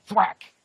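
loud, high cartoon smack noise; thwack sound effect. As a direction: belt out the hit like a comic-book sound. Demonstrating a nut tap.

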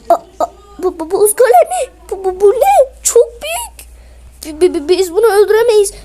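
frightened squeal with a half laugh; scared stammer. Acted. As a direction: stammer in alarm at the giant golem. Facing huge ice golem, stuttering.

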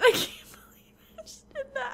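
laughing whisper. Real, not acted. Repeats it, laughing.

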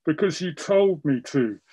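a child muttering into fabric, lightly voiced; muffled child voice. A narrator's reading. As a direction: speak as if face is buried in cloth. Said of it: "she says in a muffled voice".

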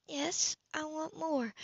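a hint of a breathless woman, hushed; soft female reply. A narrator's reading.

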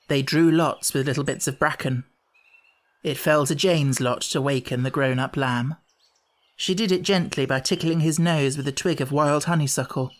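ordinary delivery

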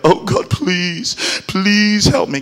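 hammed-up begging to God; mock begging. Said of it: "want to beg God".

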